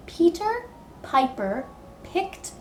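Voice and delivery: slow, careful tongue twister, every P crisp; slowly. Shown as a demonstration. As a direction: spell it out slowly so they can follow along. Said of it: "Can you try saying it with me slowly?".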